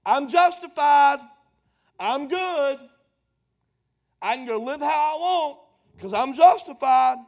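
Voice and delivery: loud, cocky "I'm justified"; mock smug believer. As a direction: brag like you're off the hook. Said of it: "a lot of people stop".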